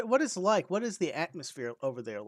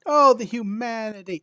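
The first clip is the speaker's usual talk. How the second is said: loud, higher, hammed-up lament; mock-dramatic cry; 6 semitones higher, 11 dB louder than usual. Echoes famous disaster quote, hammed up.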